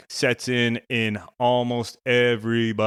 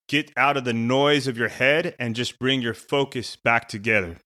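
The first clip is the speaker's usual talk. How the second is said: ordinary talk, nothing marked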